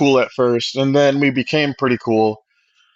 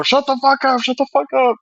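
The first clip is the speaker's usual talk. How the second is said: retold angry outburst; 7 semitones higher than usual. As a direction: re-enact a heated comeback, pitched up high. Re-enacts shouting match while handcuffed.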